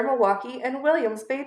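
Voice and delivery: usual speaking voice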